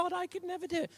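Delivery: high, pleading "I could never"; whiny protest to God. Retold or imitated. Pastor imitating a reluctant believer, high and pleading. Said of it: "You go".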